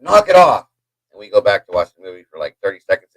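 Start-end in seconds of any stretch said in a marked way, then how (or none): 0.0-0.7 high, loud snap upward; calling upstairs. Retold or imitated. Wife retold telling the noise to stop, loud and high. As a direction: bark it up at the ceiling. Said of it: "she said".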